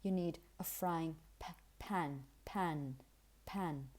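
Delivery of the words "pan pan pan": "pan" with a puff of air after the p; low, English "pan" with aspirated p. Shown as a demonstration. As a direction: release the p of "pan" with breath. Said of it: "a bit of an aspiration".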